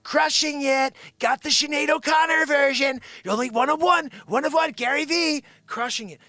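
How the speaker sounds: high, loud mock sales hype; hype-man shouting. Imagining Gary V selling doll editions, shouted hype. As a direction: belt it out like a hyped-up pitch.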